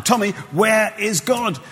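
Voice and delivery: the final, looming demand; stern vicar. Retold or imitated. Vicar demanding an answer a third time, slow and intense. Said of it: "the vicar said one more time".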